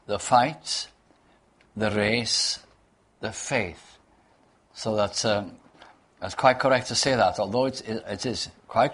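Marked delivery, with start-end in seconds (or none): 0.1-4.0 each noun set apart: the fight, the race, the faith, with pauses; stress on fight, race, faith. Shown as a demonstration. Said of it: "but rather on these three words".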